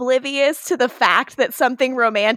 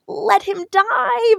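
mock innocent; 11 semitones higher, much slower than usual. Catherine quoted asking Nadja.

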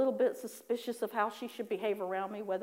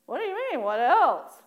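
high swoop, louder at the end; indignant disbelief; 4 semitones higher, 7 dB louder than usual. Retold or imitated. Worshipper answering God's demand for more prayer, high mock indignation. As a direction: throw the question back, mock affronted. Acted indignant reply to God.

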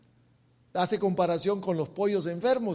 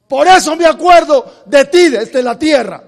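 loud, ringing sermon delivery; fiery preaching; 6 semitones higher, 19 dB louder than usual. Acted. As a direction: preach it out to the congregation. Preacher at full force.

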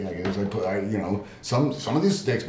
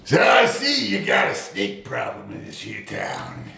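gruff cowboy; 10 semitones lower than usual. Acted. Cowboy snake catcher arrives.

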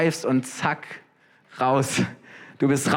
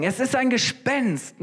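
mock terrified cry, higher; 7 semitones higher than usual. A narrator's reading. Disciples seeing Jesus on the water, shrill fright. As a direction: disciples crying out in fear, a touch of panic. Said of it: "schrien voller Entsetzen".

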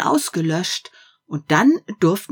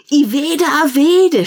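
put-on high, loud child's cry; children shouting; 10 semitones higher, 7 dB louder than usual. Some children shouting their choice, high and loud. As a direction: shout it out like an eager kid. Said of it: "schrien einige".

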